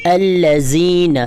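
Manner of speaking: الذین slowly, with the ذ said softly and not hard. Shown as a demonstration. Teacher showing the soft ذ, slowly. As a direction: ease gently through the ذ in الذین. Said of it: "زال کو پڑھنے کے time پہ سختی نہیں کرنی".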